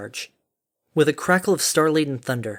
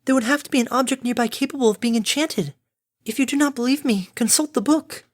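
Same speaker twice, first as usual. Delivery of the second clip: much higher character voice; high, insistent voice laying down the facts; prim, insistent Fluttershy; 11 semitones higher than usual. Acted. Fluttershy points Harmony to the book, insistent.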